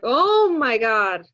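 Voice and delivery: loud, drawn-out mom exclamation; hammed-up motherly outcry; exclaiming mother. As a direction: imitate an exasperated mother, slow and loud. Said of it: "And she's like".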